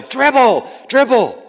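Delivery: high, loud sideline shout; coach's yell. Retold or imitated. Coach to boy running with the ball, yelled. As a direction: holler a sharp command at a player, imitating a coach. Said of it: "I'd yell".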